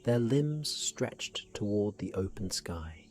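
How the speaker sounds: usual speaking voice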